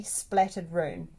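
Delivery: ordinary delivery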